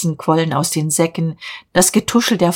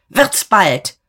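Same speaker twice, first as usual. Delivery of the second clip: raised, hurrying command; impatient shout; 3 semitones higher, 3 dB louder than usual. A narrator's reading. Boss hurries hidden girl out, impatient shout. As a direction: hurry her up with a cross call. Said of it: "schrie Diederich".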